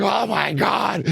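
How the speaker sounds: high, loud "Oh my God" of pure amazement; amazed shout. Retold or imitated. The whole band hearing the drum take, shouting in amazement. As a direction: shout it out, blown away by the drums. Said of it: "we're all like".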